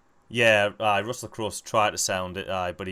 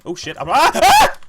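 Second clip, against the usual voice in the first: real high shriek breaking into laughter; swear, then scream in sudden fright; startled scream; 11 semitones higher, 12 dB louder than usual. Real, not acted. Gamer ambushed by squids on leaving the trap, startled scream.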